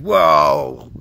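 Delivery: hammed-up stuffy old gentleman; mock a huffy blowhard; a haughty official clearing his throat; pompous harrumph. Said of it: "And they're like".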